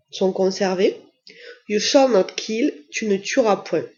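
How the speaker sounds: usual speaking voice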